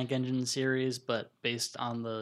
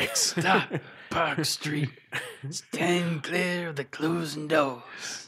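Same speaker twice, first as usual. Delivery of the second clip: mumbled subway intercom; 2 semitones higher, much slower than usual. Pretend. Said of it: "have him do the announcements".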